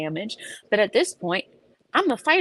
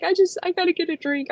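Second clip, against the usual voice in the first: much higher, fast frazzled whine; frazzled self-quote; 12 semitones higher, faster than usual. Retold or imitated. Deciding she needs a drink, frazzled whine. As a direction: rush it like you're falling apart. Retold desperate thought.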